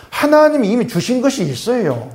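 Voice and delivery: usual speaking voice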